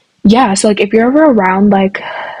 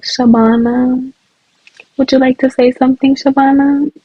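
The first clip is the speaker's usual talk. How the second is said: a hint of a sing-song announcer calling Shabana; lightly voiced host; 4 semitones higher than usual. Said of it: "It's just like".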